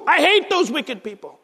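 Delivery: a hint of the psalmist's sudden anger; mild mock outburst. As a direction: voice David turning on the wicked. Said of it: "all of a sudden, boom".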